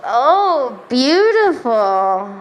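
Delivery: low, very slow, drawn out; dreamy admiring. Retold or imitated. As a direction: swoon over her own clear skin. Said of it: "And I'm like".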